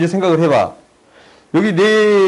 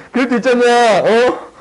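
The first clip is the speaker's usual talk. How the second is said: laughing; 9 semitones higher, slower than usual. Real, not acted. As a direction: laugh it out, still giddy. Laughing at own mom impression.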